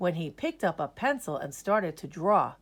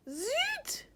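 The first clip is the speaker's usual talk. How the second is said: high light French zut; French exclamation; 6 dB quieter than usual. Acted. French teacher exclaims zut in dismay. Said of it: "the French teacher said".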